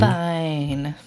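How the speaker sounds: put-on grunted one-word teen reply; sulky teen mumble. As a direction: mumble it like a bored teenager. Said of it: "Sullen teen's response".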